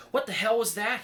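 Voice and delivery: high, loud startled shriek. Acted. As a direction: shriek it out in alarm. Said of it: "he shrieked".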